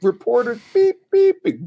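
high mock rant with censored beeps; mock yelling actor. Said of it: "He's yelling, he's ranting".

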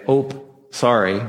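a light Midwestern Wisconsin "Ope, sorry", quick and apologetic. Retold or imitated. Preacher mimicking how Wisconsin folks apologize, in a light Wisconsin accent. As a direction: toss off "Ope" like a Wisconsinite bumping someone. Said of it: "Or as Wisconsin say".

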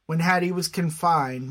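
ordinary delivery

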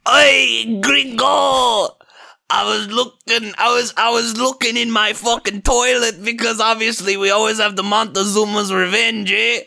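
high, drawn-out cartoon Mexican accent; mock Mexican guard accent. Guard hollering at the intruder, mock Mexican accent. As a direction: call it out like a hammed-up Mexican guard. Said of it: "all the guards be like".

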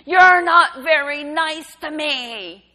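loud, high, drawn-out yell; imitated child's scream. Son screams at his mother from the landing, loud imitated scream. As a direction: scream it slowly like a child in a meltdown. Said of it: "He stood on the landing and screamed".

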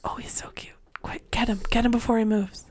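soft excited whisper; hush, don't scare him off. Real, not acted. Whispering so pet won't move.

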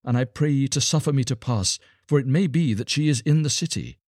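high-pitched, earnest plea; pleading beggar boy. A narrator's reading. Star child begs soldiers to let him pass, pleading. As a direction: beg to be let through, voice lifted high.

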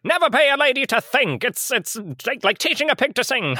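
put-on high-pitched old railroad man voice; old-time railroad man. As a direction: play a caricature railroad boss of old. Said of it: "I'm an old-time railroad man".

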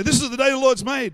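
ringing confession of faith; bold morning declaration. Acted. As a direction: declare it out loud as you wake up.

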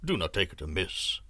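low, smooth sorcerer voice; a low, coaxing stranger; low looming threat. A narrator's reading.

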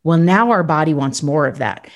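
ordinary delivery

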